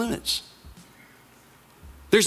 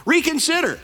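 begging; 10 semitones higher, slower than usual. Acted. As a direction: plead for mercy, higher in pitch and slowing on the second please. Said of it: "the guy begs him".

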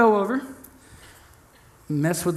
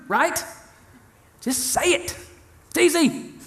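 rising pitch, pleading and fed up; mock indignant rant; 3 semitones higher than usual. Preacher ranting at an imagined door-ignoring stranger, exasperated.

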